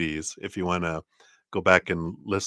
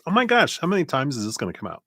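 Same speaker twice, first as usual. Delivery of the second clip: surprised; 7 semitones higher, much faster than usual. Real, not acted. Same card drawn again.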